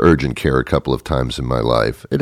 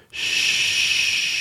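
shushing; 5 dB quieter than usual. Acted. Hushing the listener.

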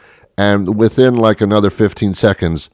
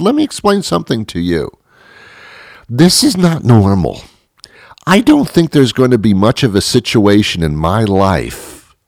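high-pitched, emphatic comic protest; indignant rant; 4 semitones higher than usual. Acted. Patient arguing with the tester over stress test results, acted indignation. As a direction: lay out the complaint in measured, exasperated bursts.

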